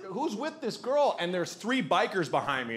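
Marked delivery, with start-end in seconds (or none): 0.0-1.2 mock bewildered ask. Asking bikers about the beer thief.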